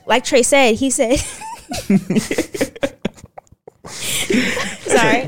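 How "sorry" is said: giggling while trying to restart; breaking into laughter mid-sentence; laughing. Real, not acted. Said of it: "this is the wrong moment to laugh".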